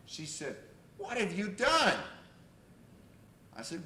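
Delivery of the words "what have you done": loud, high mock demand; retold fury. Furious client confronting her therapist, loudly accusing. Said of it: "she was absolutely furious with me".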